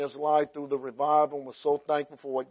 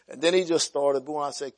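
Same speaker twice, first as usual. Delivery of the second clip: ordinary talk, nothing marked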